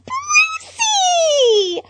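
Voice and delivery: very high, loud squeal. A narrator's reading. Harley spotting Bruce, squealing his name. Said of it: "Harley Quinn squealed".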